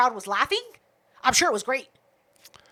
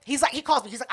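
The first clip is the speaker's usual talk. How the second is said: ordinary delivery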